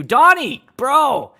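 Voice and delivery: loud, high-pitched greeting to a buddy; excited shout-out. Real, not acted. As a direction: holler the name like spotting a mate.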